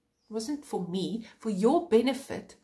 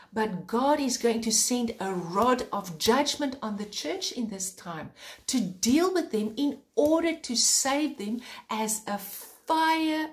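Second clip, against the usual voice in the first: raised, urgent preaching with mounting fervor; rising preacher's urgency, higher pitched; fervent preaching; 4 semitones higher than usual. Acted. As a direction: preach it like pulling someone from fire.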